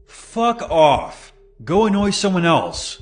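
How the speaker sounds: high-pitched yell, no extra volume; irritated yell. A narrator's reading. Scared woman to the clown outside, irritated high yell. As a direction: call out high and annoyed at a pest. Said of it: "yelled out to the clown".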